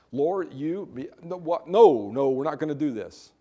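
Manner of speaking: halting, with a loud burst on no no; outraged Peter refusing, words tripping; mock indignant stammer; indignant sputter. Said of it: "he's so indignant about this that he's stuttering".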